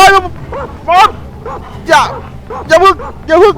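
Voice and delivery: อย่าพึ่ง yelped very high and loud, rising; panicked plea. Real, not acted. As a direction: screech อย่าพึ่ง in real fright. Wolves about to catch cat. (ร้อง อย่าพึ่ง เสียงสูงมาก ดัง ขึ้นเสียงท้าย)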